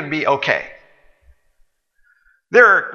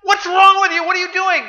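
much higher, louder outraged shout; incensed waiter; 4 dB louder than usual. Acted. Waiter outraged at the customer, shrill angry shout. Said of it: "the waiter is incensed".

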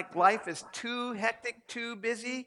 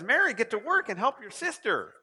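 louder, higher acted order; mock scolding; 5 semitones higher, 5 dB louder than usual. Imagined Jesus telling Mary to help, mock scolding. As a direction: play at ordering a slacker to work.

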